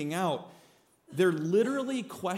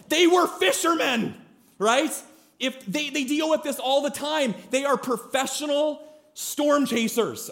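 excited high emphasis; 5 semitones higher, 3 dB louder than usual. Real, not acted. Preacher emphatic rising.

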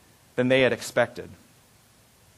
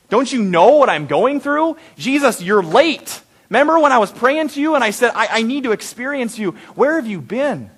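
higher, demanding plea to God; upset, accusing prayer; 5 semitones higher, 3 dB louder than usual. Acted. Preacher voicing a believer challenging Jesus, upset and accusing. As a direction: press the complaint, pitch lifted.

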